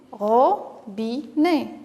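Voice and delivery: "Robinet" very slow, ro, bi, né; syllable by syllable. Shown as a demonstration. Teacher helps children place a cross, syllable by syllable. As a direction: pull "Robinet" apart with a pause after each syllable. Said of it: "découper chaque mot".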